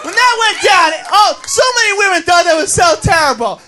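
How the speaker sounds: loud comic rant. Acted. Comic ranting to the crowd, loud. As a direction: shout it at the crowd in mock outrage.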